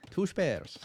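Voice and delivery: hammed-up Irish voice; Irish accent. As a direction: say "Two spares" like a stage Irishman. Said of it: "it's obviously an Irish accent".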